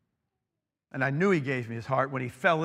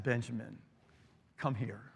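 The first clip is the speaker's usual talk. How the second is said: soft, tender call, much softer; gentle call; 4 semitones lower, 9 dB quieter than usual. Retold or imitated. Father on his knees softly calling his son in. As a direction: coax him into your arms. Kneeling father, arms open.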